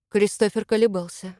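ordinary delivery, nothing marked

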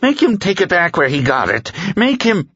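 terrified villain whining for help; whining dark man. A narrator's reading. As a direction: plead in a panicked whine.

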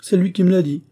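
high, loud comeback; prompt retort. A narrator's reading. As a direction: answer straight back, pitched right up. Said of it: "répliqua promptement l'interpellée". (réplique prompte, aiguë et forte)

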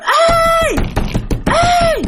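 very high, loud ghostly yell; high shrieking ghost call. Acted. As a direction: scream out after him like a demon. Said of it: "nghe tiếng nó kêu".

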